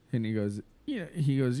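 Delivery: normal talking voice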